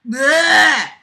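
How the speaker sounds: high, loud gagging bleh; loud disgusted bleh. Real, not acted. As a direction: gag at the prose. Disgusted noise at the writing.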